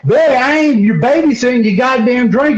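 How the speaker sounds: high-pitched, fed-up retort; exasperated protest. Retold or imitated. Friend refuses to babysit Billy's drink, exasperated. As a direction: protest like you're sick of being asked. Acted retort in retold squabble.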